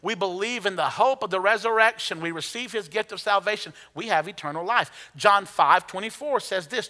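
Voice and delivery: ordinary delivery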